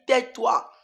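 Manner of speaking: high, loud barked command; loud devil's command; devil's shout. Retold or imitated. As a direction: snap it like the devil. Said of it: "C'est comme ça qu'il a crié".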